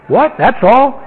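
a lightly mocking, rising "that's all?"; incredulous. Scoffer hears the whole answer, mildly incredulous rise. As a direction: play a scoffer who can't believe it. Listener balks at simple answer.

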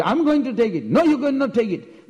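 high-pitched stubborn back-and-forth; insist, as in a tug of war; acted squabble.

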